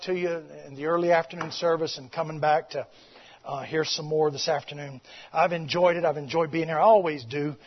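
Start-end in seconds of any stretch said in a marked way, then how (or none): none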